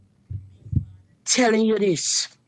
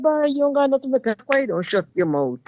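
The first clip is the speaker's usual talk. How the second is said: higher, scornful telling-off; retold retort; 7 semitones higher than usual. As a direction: brush him off with contempt. Telling off the boyfriend.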